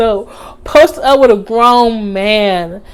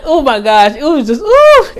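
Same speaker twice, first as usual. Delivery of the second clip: ordinary talk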